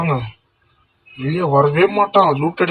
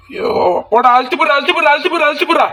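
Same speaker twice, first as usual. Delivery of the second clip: frantic urging; 9 semitones higher, much faster than usual. Real, not acted. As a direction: yell over and over to push the attack. Urging during a fight.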